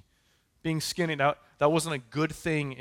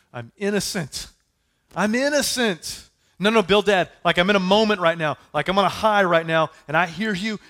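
loud, high, insistent innocence; indignant protest; 6 semitones higher, 8 dB louder than usual. Acted. Job protesting to Bildad that he did nothing, loud and high. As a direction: shout your innocence at Bildad. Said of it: "what were the words of Job".

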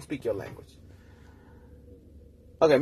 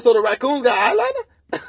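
pitched way up, louder, teasing; mocking disbelief; 11 semitones higher, 5 dB louder than usual. Needling the girl about raccoon eyeliner, mocking disbelief. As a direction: poke fun at her explanation.

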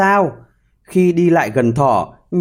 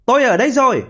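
calling out; 8 semitones higher, much faster than usual. A narrator's reading. Hedgehog's wife calling to the arriving hare, calling out. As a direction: call out high across the field. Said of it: "thì vợ nhím kêu lên".

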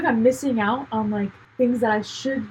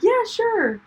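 high-pitched, eager yes; upbeat agreement; 11 semitones higher than usual. Shown as a demonstration. Accepting a friend's coffee invite, upbeat. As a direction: show a keen, willing acceptance. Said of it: "That's how I'm saying it".

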